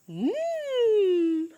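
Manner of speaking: high savoring hum; a high happy mm; high yummy mm. Acted. Teacher to kids, caterpillar savoring food, high yummy mm.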